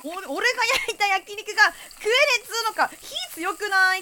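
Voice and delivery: high, loud hammed-up indignation; mock angry. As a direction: play an offended grill master. Mock angry grill master. (怒ったふりで叫ぶ)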